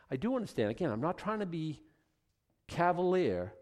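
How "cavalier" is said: ordinary talk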